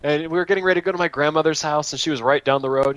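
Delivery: usual speaking voice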